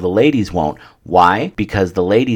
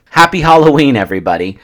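big, much higher, louder cheer on Happy Halloween; excited greeting; 6 semitones higher, 7 dB louder, faster than usual. Real, not acted. Podcast host cheerfully greeting listeners, loud excited shout. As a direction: call it out to the crowd with a grin.

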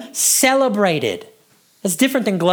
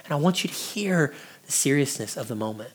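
hushed whisper; 4 semitones lower, 9 dB quieter than usual. Shown as a demonstration. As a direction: drop to a hushed whisper to draw listeners in. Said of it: "I begin to talk like this".

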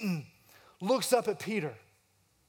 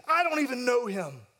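quick denial; 6 semitones higher than usual. Retold or imitated. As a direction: snap the denial out defensively. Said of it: "Here he quickly says".

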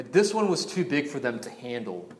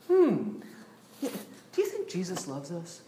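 mock musing; 5 semitones higher, 4 dB quieter than usual. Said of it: "not honestly musing like".

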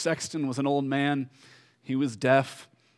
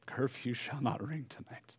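hushed whisper; 10 dB quieter than usual. Retold or imitated. Cromwell's verdict in the story.

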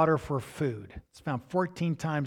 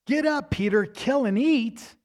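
higher, booming call from above; commanding heavenly voice; 8 semitones higher, 4 dB louder than usual. Acted. Preacher voicing the heavenly command to Peter, higher and ringing.

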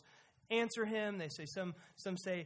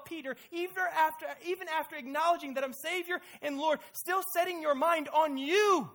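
higher and much louder correction; forceful rebuke; 8 semitones higher, 9 dB louder than usual. Acted. Jesus rebuking Peter, preached.

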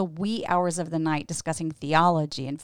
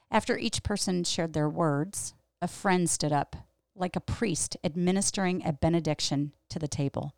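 ordinary delivery

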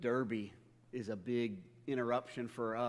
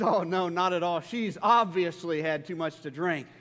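louder, laughing put-down; wife's dry reply; 4 semitones higher, 8 dB louder than usual. Retold or imitated. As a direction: tease him with a chuckle. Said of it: "She says".

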